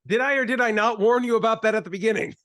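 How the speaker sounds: loud, fast, high gloating; I told you so. Real, not acted. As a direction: gloat with a triumphant shout.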